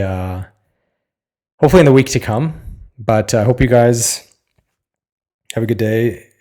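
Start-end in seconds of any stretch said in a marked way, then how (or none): none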